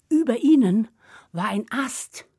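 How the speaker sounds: usual speaking voice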